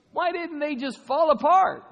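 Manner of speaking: incredulous high cry; baffled. Pretend. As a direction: ask it in loud mock bewilderment. Imagined believer baffled.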